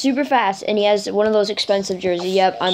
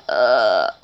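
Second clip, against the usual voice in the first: low, drawn-out mock "uh"; imitated dumb "uh"; 11 semitones lower than usual. Player replaying own blunder, mock dopey groan. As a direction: act out your own clueless reaction, low. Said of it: "And then I'm just like".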